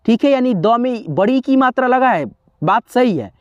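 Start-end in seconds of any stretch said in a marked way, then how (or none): none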